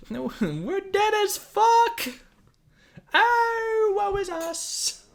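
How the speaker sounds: hammed-up doom from children in a cage; wailing kids. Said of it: "It's like".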